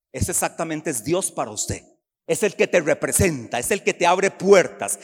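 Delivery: ordinary talk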